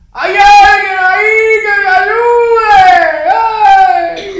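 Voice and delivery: long, very high desperate shout; hammed-up cry from someone hanging on; screaming for help. Climber hanging from a branch calling out, desperate scream. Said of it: "Y empieza a gritar".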